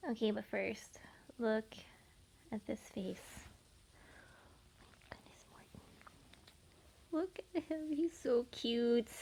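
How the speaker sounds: soft whisper. Real, not acted. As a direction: whisper so as not to wake him. Whispering by sleeping dogs.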